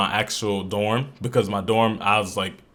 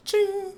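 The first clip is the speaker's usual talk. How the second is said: high-pitched "ching"; 3 dB quieter than usual. Shown as a demonstration. Cook voicing over the broccoli prep, high mimicked ching. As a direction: sing out a tiny, very high ching. Imitates a noise while cooking.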